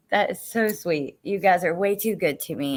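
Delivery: ordinary delivery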